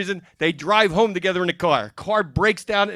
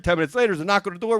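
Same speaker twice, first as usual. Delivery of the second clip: normal talking voice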